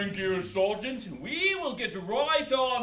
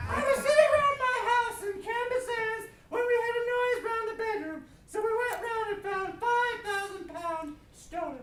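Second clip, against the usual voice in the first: high voice; 6 semitones higher, 4 dB quieter than usual. Acted. Keeps the squeaky voice.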